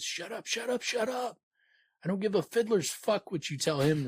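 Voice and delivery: high, frantic shrieking at the storyteller; Bass flipping out. Acted. Bass snapping at the chronicler, high frantic outburst. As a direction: screech it like he's lost control. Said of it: "Bass continuing to flip out".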